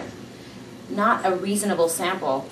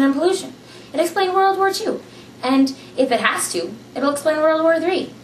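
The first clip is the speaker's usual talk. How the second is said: rising emphatic insistence; 10 semitones higher, 3 dB louder than usual. A narrator's reading. Ishmael pressing the narrator with rising, louder insistence. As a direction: pitch climbing higher and louder as the point builds.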